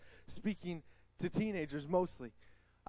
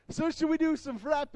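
higher and louder mock offer; teasing question; 8 semitones higher, 7 dB louder than usual. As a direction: tease the crowd playfully. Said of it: "And I'm like".

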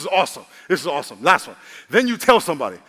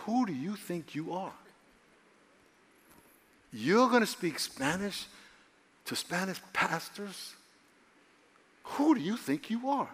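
doubting inner voice; 3 semitones higher, 7 dB quieter than usual. Retold or imitated. Inner doubt taunting the preacher before he speaks, mildly sneering. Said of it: "this little voice said".

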